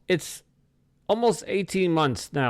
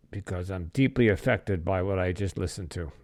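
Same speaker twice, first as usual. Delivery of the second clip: low, subdued and really moved; real, sober heaviness in the voice; deeply affected; 5 semitones lower, 3 dB quieter than usual. Real, not acted. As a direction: speak low, weighed down by what you heard. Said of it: "you might even be able to hear it in my voice".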